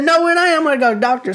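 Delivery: high-pitched, insistent comeback; retold protest. Grandson refuses pa's advice, insistent protest. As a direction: argue back, voice jumping up. Arguing back to pa.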